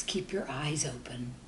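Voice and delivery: ordinary delivery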